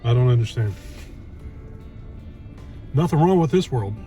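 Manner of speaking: normal talking voice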